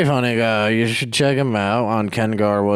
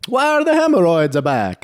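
put-on Mexican accent; 8 semitones higher, 4 dB louder than usual. Doctor accent bit.

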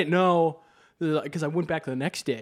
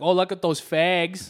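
louder, higher, drawn-out Minnesotan vowels; Minnesota accent slipping out; 6 semitones higher, 5 dB louder than usual. Retold or imitated. Minnesotan showing what he still says, loud Minnesota accent. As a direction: let broad Minnesota vowels slip out loudly. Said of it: "I still say things like".

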